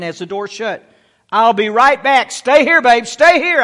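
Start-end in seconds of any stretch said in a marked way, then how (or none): 1.3-3.6 urgent acted yell, higher and louder; retold yell. Father to daughter as the elevator door shuts, acted urgent yell. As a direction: call out urgently to a child left behind. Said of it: "I yelled it".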